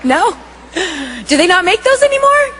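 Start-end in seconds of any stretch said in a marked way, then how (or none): 1.3-2.5 high, quick incredulous question; playfully taken aback, higher voice; mock disbelief. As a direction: pitch it up in comic dismay.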